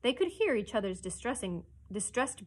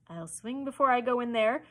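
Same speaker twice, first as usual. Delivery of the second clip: louder, higher, stubborn refusal; dogged; 4 semitones higher, 6 dB louder than usual. A narrator's reading. Starkey would sooner swing than enter, dogged. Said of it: "Starkey doggedly".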